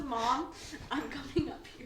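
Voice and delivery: laughing dog voice; pretend dog voice. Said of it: "He says".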